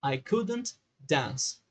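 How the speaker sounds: very slow, couldn't drawn out; pronúncia modelo lenta; slow model pronunciation. Shown as a demonstration. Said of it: "E ela vai pronunciar assim".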